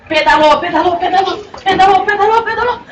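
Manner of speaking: building pedalling. A narrator's reading. Pedalling faster and faster.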